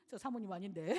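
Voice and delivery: ordinary talk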